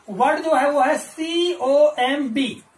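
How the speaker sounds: ordinary talk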